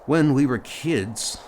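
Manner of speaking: usual speaking voice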